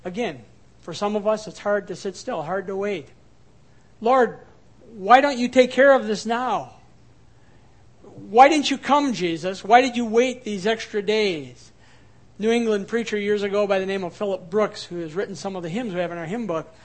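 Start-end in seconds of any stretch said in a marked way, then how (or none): 4.0-11.7 loud pleading cry to the Lord; anguished plea. Acted. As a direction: cry out, why did you wait. Voicing anguished plea to Jesus.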